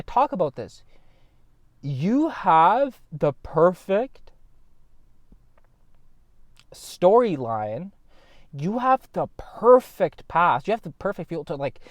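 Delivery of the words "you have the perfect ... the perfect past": each word drawn out slowly to drive the point home; slow emphatic. Real, not acted. Vlogger urging elevens, slow and emphatic. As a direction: stretch out perfect storyline and perfect past.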